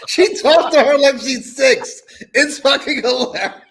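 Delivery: high, loud words through laughter; can barely get it out for laughing; laughing while talking. Real, not acted. Cohost laughing about the clip.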